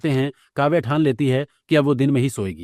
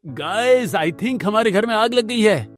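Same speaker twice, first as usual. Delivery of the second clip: vlogger addressing viewers; 8 semitones higher, slower than usual. Acted. Vlogger son filming the fire.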